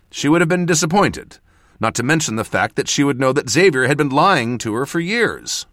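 high, loud, insistent justification; heated self-defence. A narrator's reading. Mentor defending omission to apprentice, heated. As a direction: argue back, agitated.

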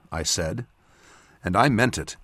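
ordinary delivery, nothing marked

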